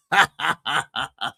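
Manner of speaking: laugh. Real, not acted. Host laughing at his own mistake. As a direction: burst out laughing.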